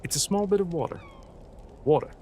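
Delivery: careful American accent, leaning on the R in "water"; American accent attempt. Shown as a demonstration. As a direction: try it American, hitting the final R. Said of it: "when it comes to American".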